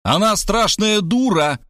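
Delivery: high, slow yelled outburst; yelling. A narrator's reading. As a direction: howl it in frustration. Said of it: "завопил я".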